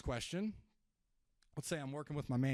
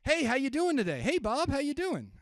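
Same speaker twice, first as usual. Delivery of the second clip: loud, high, pushy "Hey, Bob, how you doing?"; salesy excitement; 12 semitones higher, 9 dB louder than usual. Shown as a demonstration. Caller greeting seller Bob, loud and salesy. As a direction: sound like an eager salesman. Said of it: "When we're calling them and we're saying".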